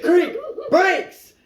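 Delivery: high-pitched retold yell; urgent warning yell. Passenger warning the driver to stop, urgent yell. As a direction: call out an alarmed warning, half laughing.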